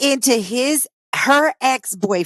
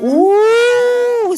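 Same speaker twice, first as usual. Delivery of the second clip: high, loud drawn-out ooh; surprised ooh; 13 semitones higher, 6 dB louder than usual. Real, not acted. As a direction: gasp at the juicy news. Reacts to juicy gossip.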